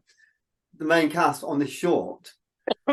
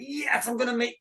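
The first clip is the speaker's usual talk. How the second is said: higher, a little hushed thrill at meeting a hero; excited; 7 semitones higher, 4 dB quieter than usual. Retold or imitated. Actor recalls meeting Daniel Craig, excited. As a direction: gush with thrilled disbelief. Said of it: "I was so excited".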